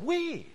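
"We" leapt up high and hit hard; emphatic We. Shown as a demonstration. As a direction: punch the word "We" up high. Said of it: "accentuated in the Greek text with a very emphatic".

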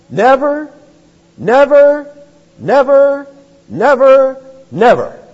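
Never shouted five times, high and loud; rising shouted title. Retold or imitated. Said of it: "The title of his sermon was".

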